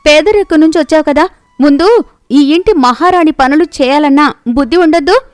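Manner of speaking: jealous sister-in-law tearing into the younger bride; scolding. Acted. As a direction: berate her like a haughty mistress of the house.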